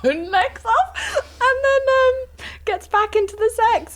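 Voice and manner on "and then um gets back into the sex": high, breathless laughing; laughing so hard it's almost crying. Real, not acted. Laughing through the story.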